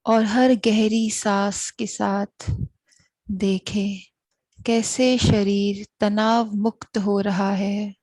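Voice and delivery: very slow, drawn-out meditation guidance, long pauses between phrases; guided meditation. Real, not acted. Host guiding listeners to watch their breath, slow meditative voice. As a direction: lead them into stillness, unhurried and even.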